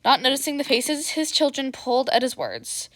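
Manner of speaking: ordinary delivery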